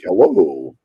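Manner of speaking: slow, neutral American newscaster's "hello"; drawn-out, carefully neutral General American; accentless news voice. Shown as a demonstration. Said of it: "actors and news people are taught".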